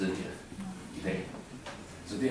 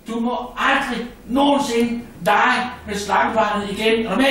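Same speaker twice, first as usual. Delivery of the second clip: high, loud scolding mother; 10 semitones higher, 7 dB louder than usual. Acted. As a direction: tell the child off, shocked and furious. Frog mother scolds her child. (frømoren skælder ud, høj og vred)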